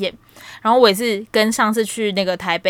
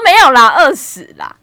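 loud laughing fib, much higher; 5 semitones higher, 12 dB louder than usual. Retold or imitated. Telling friend she claimed to be twenty, laughing loud. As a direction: shout the lie through laughter. Retold lie about age, laughing.

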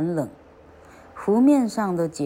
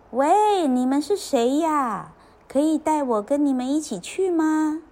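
calling out; 9 semitones higher than usual. A narrator's reading. Ugly duckling begs to join the swans, calling out. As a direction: call up to someone far away. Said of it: "他就叫他们".

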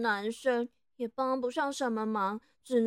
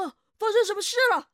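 alarmed; 6 semitones higher, 8 dB louder, much faster than usual. Acted. Husband bursts in alarmed.

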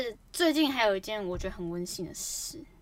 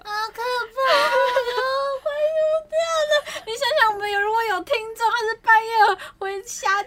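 high, crying, hammed-up fright; mock terrified wail; 12 semitones higher, 8 dB louder than usual. Cohost reacting to the ghost story, hammed-up terrified crying. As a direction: wail in playful horror.

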